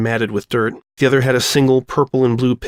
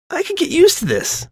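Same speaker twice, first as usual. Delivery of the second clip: put-on high girlish voice. Sanada's happy line after hug.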